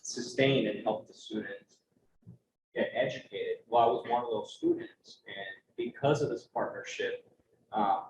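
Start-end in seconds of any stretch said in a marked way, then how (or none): none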